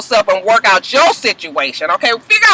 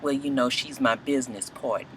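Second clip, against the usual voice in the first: much lower and softer, a lazy mock Stevie J; 12 semitones lower, 15 dB quieter than usual. Stevie J to the doctor, low soft imitation. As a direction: play him down low and soft, shrugging it off. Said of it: "Stevie J is just like".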